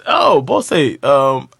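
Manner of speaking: hammed-up dated movie line, high and loud, through laughs; mock old-movie dad. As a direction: quote the old film dad while cracking up. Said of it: "talking like this".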